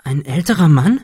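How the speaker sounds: startled concern, lifting in pitch; astonished, concerned. A narrator's reading. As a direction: ask it in worried disbelief. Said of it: "fragte Cecil erstaunt und besorgt".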